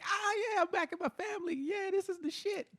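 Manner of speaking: high happy fish, back with family; high relieved fish voice. Acted. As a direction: squeak with relief as if back in the water. Voicing thrown-back fish.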